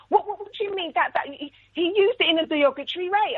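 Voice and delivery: high-pitched, stammering mock protest; flustered teacher. Retold teacher arguing back, flustered.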